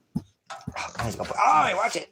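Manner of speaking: high, loud startled warning. Real, not acted. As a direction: catch the dog just in time. Dog reaching for stream.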